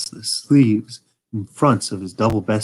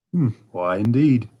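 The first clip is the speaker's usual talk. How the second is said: plain reading voice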